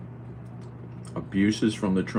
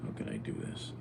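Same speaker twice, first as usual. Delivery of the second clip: whisper; 4 semitones lower, 13 dB quieter than usual. Real, not acted. To himself while the cat hovers, whispered. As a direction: mutter it under your breath. Trying to eat beside cat.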